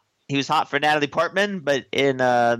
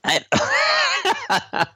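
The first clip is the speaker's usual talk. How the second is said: high squeal. Pretend. Squeal after Naboo quote.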